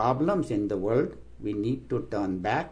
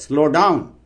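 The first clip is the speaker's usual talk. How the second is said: a loud, higher, sudden command; firm warning call; 4 semitones higher, 9 dB louder than usual. Retold or imitated. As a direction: warn her sharply to brake. The voice warns while driving.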